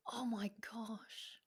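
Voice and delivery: slow, breathless whisper of awe; real whisper. Real, not acted. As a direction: let it slip out in a slow, voiceless whisper. Moved by talk of dogs.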